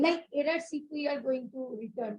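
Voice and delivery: very faint, fading away from the mic; barely audible. Real, not acted. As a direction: voice dropping to almost nothing. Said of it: "Your voice is not audible again".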